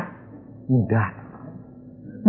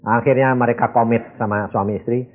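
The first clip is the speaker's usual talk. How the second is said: ordinary delivery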